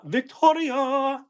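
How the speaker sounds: drawn-out high call of Victoria; calling "Victoria" in a long, high lilt; sing-song call. Shown as a demonstration.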